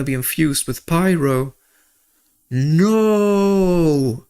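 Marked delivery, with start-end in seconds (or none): 2.5-4.3 long, high mock wail of "no"; dismayed wail. Streamer realizing his team plan fails, dismayed wail. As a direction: draw out a high, despairing no.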